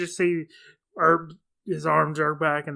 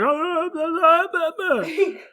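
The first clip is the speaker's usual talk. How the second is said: high-pitched babbling mock cry; mock wailing; 11 semitones higher, slower than usual. Imitating a movie villain after he falls down, high mock wail. Mimics a man falling down wailing.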